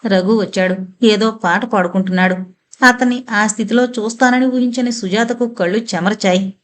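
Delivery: ordinary delivery, nothing marked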